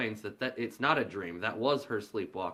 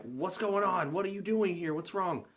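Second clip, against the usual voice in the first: much higher, alarmed questions; startled son; 9 semitones higher than usual. Retold or imitated. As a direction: wake up confused and alarmed. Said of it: "and the son's like".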